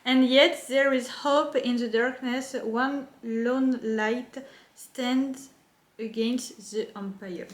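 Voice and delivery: careful English reading with a French accent. Real, not acted. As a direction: read slowly in a French accent. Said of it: "mon accent anglais, bof".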